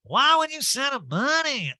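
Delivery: high, swooping mock outrage; hammed-up incredulous impersonation; Erica. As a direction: caricature her disbelief. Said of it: "And Erica's like".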